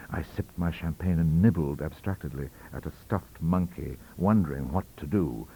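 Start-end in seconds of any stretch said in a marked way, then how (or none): none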